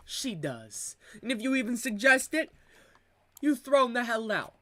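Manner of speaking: broad mock Italian-American accent, playing a wiseguy. Comedian riffing on family life in an Italian accent. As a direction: lay on a thick Italian accent like a mob cousin. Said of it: "the Italian accent".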